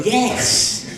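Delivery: high, loud imitation of an abrupt yes; mock shouted answer. Minister answering the caller's phone call, imitated with a high shout.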